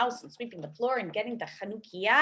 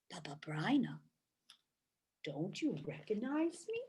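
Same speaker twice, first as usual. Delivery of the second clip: lower, softer rabbi voice; real rabbi; 4 semitones lower, 8 dB quieter than usual. Acted. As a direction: speak like a puzzled rabbi.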